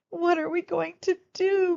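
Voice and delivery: high, worried mother's voice; distressed cry. Acted. Mother worried about her daughter, distressed cry. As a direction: cry out in despair. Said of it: "cried Missus Cream".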